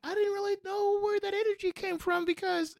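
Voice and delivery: very high impression of a fan; imitating a fan in El Paso; put-on voice. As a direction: play the fan in a high squeaky voice. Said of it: "your boy don't talk like this either".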